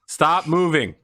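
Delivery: curt halt command from an armed guard; shouted order. Acted. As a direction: stop them in their tracks.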